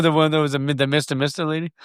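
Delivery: ordinary delivery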